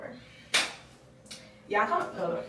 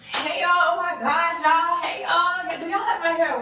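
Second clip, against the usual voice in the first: higher, put-on YouTube greeting; fake vlogger voice; 6 semitones higher, 5 dB louder, slower than usual. Vlogger greeting followers on camera, fake bubbly voice. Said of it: "how fake I talk".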